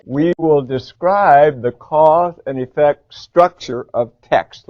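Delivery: slow, loud, deliberate choral read; slow model read. Shown as a demonstration. Teacher models the objective for the class to repeat. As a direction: read every word out slowly for students to echo. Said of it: "Let's read it".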